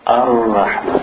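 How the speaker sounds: Ar-Rahman with a briefly trilled, held r; lightly rolled Ra. Shown as a demonstration. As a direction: roll the r just slightly in Ar-Rahman. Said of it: "A little bit of rolling".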